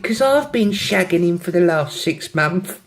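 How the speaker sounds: put-on high, drawn-out London accent. London girl confessing to her friend, London accent. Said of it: "have a go at the accents".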